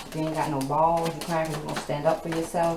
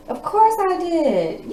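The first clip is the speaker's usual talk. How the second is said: much higher, slow and louder, drawn out; high mock reply; 11 semitones higher, 6 dB louder, much slower than usual. Reader mocking the man who got hurt, high and drawn out. Tarot reader answers the masculine.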